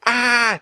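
high, loud short scream; startled yell. A narrator's reading. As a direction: yelp at the sudden shape. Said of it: "Jack yelled".

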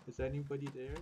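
hushed, barely voiced call; whispering while hiding. Real, not acted. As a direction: whisper it so nobody hears. Hiding from homeowner, terrified.

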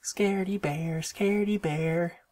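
high, singsong playground taunt; high-pitched teasing singsong. A narrator's reading. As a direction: chant it mockingly at a little sister. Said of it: "teased Brother".

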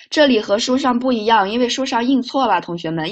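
ordinary talk, nothing marked

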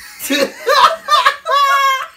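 high shrieking laugh; loud laughter. Real, not acted. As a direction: burst out in a loud cackle. Outburst of laughter during take.

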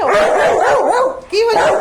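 sudden high, loud "what happened?"; startled call to the dog; surprised. Real, not acted. Talking to excited dog.

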